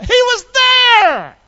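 high, loud, drawn-out shout; triumphant preacher's shout. Real, not acted. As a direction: shout the testimony out, stretching the words. Triumphant climax of testimony.

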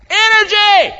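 high, loud shouted energy; scream it out. Shown as a demonstration. Preacher urging the church to scream it out. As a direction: belt the word out to the crowd. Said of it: "can you scream it out".

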